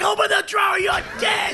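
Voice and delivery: high, loud menacing yell; shouted threat. Retold or imitated. Robber screaming at cashier, loud threat. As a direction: bark it at her.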